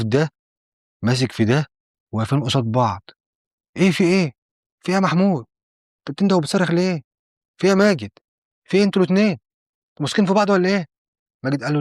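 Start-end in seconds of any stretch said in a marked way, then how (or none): 3.8-10.9 high-pitched bewildered demands; acted alarm, each question pitched up. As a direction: demand to know why they are fighting. Alarmed brother breaking up a fight.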